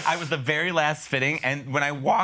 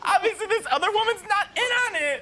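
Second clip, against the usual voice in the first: much higher through laughter; laughing. Real, not acted. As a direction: giggle the words out. Laughing commentary on clip.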